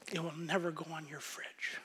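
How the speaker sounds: hushed whisper. Real, not acted. Sermon punchline dropped to hush.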